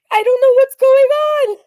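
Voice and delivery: high, loud, crying with laughter; helpless laugh-cry. Real, not acted. Reactor losing it over the clip, laugh-crying.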